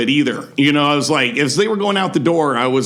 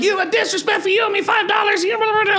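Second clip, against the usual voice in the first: very high hammed-up yelling woman; mock furious shout; faster than usual. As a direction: shriek it like an outraged heckler. Retold woman launching at him.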